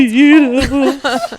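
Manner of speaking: overflowing, can barely get it out; gushing emotionally. Retold or imitated. Telling castmates about her day, gushing emotionally. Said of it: "And I was like".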